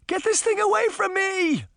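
very high-pitched alarmed cry; high, urgent yell. A narrator's reading. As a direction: cry out for help, pitch shooting way up. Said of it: "Sabrina cried".

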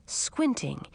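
plain reading voice

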